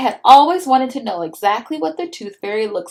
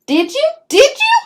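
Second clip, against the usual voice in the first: rising higher and louder each time; eager; 7 dB louder than usual. A narrator's reading. Little brother asking eagerly, excited. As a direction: bounce with eagerness.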